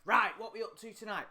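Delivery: loud, high imitation of someone drawing attention; exaggerated, high-pitched announcing; mocking attention-seekers. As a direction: blast it out to mock show-offs. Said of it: "when people do this".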